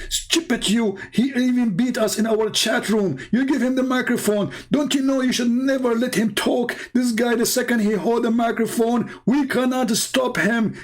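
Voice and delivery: high, mocking scold of a frustrated caller. One caller scolding another for handing over the mic, high mock scold. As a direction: play the angry scolder, high-pitched. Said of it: "he said to him".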